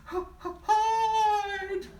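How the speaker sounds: high, loud stuttered scream; stammering panic. Acted. Mouse screaming at everyone to hide. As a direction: stutter it in terror.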